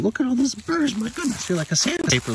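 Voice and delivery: high, loud cooing. Real, not acted. Owner coos high at his cat covered in burrs.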